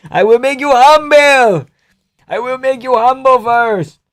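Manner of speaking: high, slow, strained voice; Merab impression. Streamer showing chat how to strain for Merab, high. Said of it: "you gotta strain your voice".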